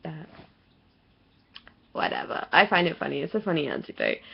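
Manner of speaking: normal talking voice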